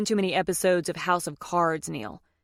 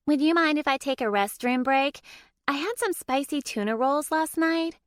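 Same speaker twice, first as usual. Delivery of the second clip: higher, timid woman's voice trailing off; sheepish court reporter; 7 semitones higher than usual. A narrator's reading. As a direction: ask the favour awkwardly, embarrassed. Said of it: "Her voice trailed off".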